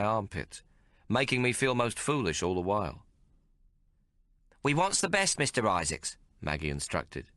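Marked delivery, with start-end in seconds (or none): 4.6-6.2 put-on high, breezy street girl; brash London lass showing off her tailor; Maggie's cockney voice. A narrator's reading. Maggie introducing the tailor to Hawk, brash and cheerful.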